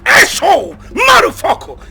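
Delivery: furious shouting; screamed insults. Acted. Furious man insults victim.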